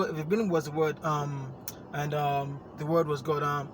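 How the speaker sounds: rushed start, then stalling on ums; hesitant, filler-ridden delivery. Shown as a demonstration. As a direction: fumble the line with dragged ums. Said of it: "stop saying, um".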